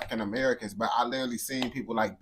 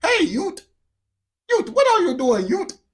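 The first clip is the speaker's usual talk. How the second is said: very high, loud mock British voice; high-pitched British accent; 8 dB louder than usual. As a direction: squeal out a caricatured British accent, far above your range. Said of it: "a more higher pitch British accent".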